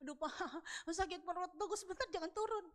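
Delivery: high, moaning mock pain; put-on pained plea; faked stomach ache. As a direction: groan as if your belly hurts. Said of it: "acting ah sama suami saya bilang".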